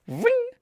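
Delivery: very high, quick mock whizz; imitated zipping noise. Planchette shooting over to yes, high imitated zip. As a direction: voice the pointer zipping across in a squeak.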